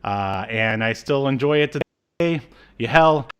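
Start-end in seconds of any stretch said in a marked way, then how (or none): none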